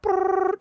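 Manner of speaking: high buzzing imitation of phone static; cell phone interference buzz. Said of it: "it sounds like a cell phone interference".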